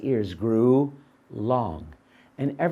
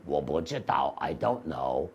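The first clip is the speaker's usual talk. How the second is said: dopey donkey; 12 semitones lower, 7 dB quieter than usual. Acted. Donkey answers in Chinese.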